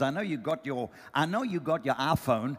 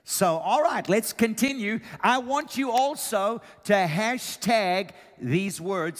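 higher, louder preacher push; preaching loud; 6 semitones higher, 4 dB louder, slower than usual. Real, not acted. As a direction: rev up the room. Preacher revving up.

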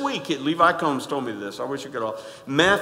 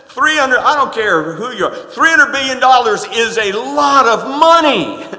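louder, higher insistence; emphatic preaching; 6 semitones higher, 8 dB louder than usual. Real, not acted. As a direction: hammer home the size. Preacher stresses huge sum.

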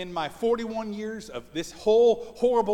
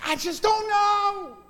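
higher, slow, whiny wife imitation; upset wife; 9 semitones higher, 4 dB louder, slower than usual. Wife upset when asked about dinner, imitated higher and slower. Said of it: "she starts getting so upset".

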